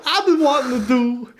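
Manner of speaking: mocking a cracked voice, very high and loud; laughing, exaggerated squeaky imitation; hammed-up high-pitched mimicry through laughter; John Travolta. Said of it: "you sound like John Travolta in the seventies".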